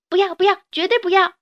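put-on high, loud child's refusal; a kid refusing to leave dad; child protesting. 孩子哭着拒绝分开. (小孩子大声哭喊不要)

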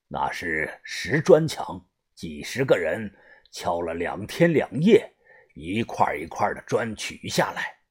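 very low, soft voice of the boss; gang leader, grave. A narrator's reading. Gang leader explaining how few options they have, grave and hushed. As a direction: speak deep and hushed, weighing the options.

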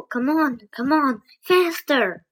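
building urgent shout; urgent order. Acted. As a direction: hurry them along, pushing harder each time. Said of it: "Jack ordered".

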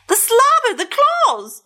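high, horrified squeals. Acted. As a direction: recoil at each scary detail. Pigeon horrified by the dog.

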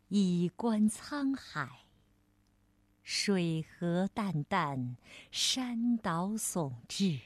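slow, low, measured recital; unhurried reading with pauses between phrases; classical poem recitation. Acted. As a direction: recite it solemnly, drawing out each syllable.

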